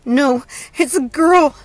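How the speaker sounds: high, loud, slow distress; pained, panting. A narrator's reading. Hope tells her sister something is wrong, pained and panting. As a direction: struggle each phrase out through pain. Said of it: "Hope panted, the pain finally subsiding".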